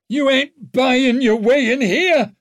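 high, loud and slow shout; shrill shout. A narrator's reading. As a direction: yell down from the wall, shrill. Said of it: "shouted Silent ... a little shrill".

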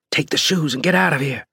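high, pressing urgency; urging. A narrator's reading. Rescuer sending freed woman out of the house, urging. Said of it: "I urged".

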